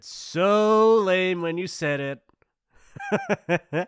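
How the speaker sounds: high, loud drawn-out mockery; gleeful ridicule. Bully ridiculing a victim's rehearsed line, gleefully mocking. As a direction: stretch it out, breaking into laughter.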